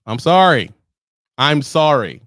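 exaggerated loud apology; mock apology. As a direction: call out sorry to the crowd.

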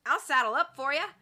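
Shorty volunteering to saddle up; friendly cowboy. Acted. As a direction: offer it with a grin.

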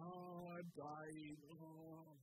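hushed, drawn-out fake exhaustion; mock fasting martyr. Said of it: "kind of like".

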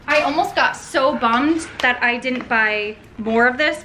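ordinary delivery

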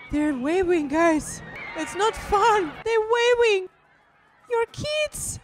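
loud, rising cry of dismay; distressed outcry. Real, not acted. Viewer to audience about waving kids, distressed outcry.

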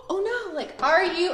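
high, hammed-up "oh no"; mock dismay. Teacher fakes alarm to the class, hammed-up dismay. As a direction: act shocked for the kids. Pretend a word fell off.

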